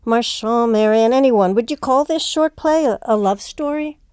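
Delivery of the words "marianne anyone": normal talking voice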